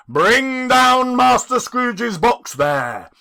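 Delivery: loud, slow, booming command; terrible bellow. Acted. Schoolmaster bellowing orders from the hall, loud and slow. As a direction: roar it down the hall. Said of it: "A terrible voice in the hall cried".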